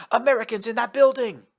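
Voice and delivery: loud, high alarm shout; shouting orders. Acted. Yamamoto shouting to the BTR driver. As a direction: point them out and shout.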